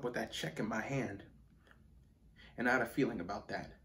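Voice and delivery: low, soft and held back; subdued confiding. Acted. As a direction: start quietly, as if confiding a hurt. Subdued opening of monologue to father.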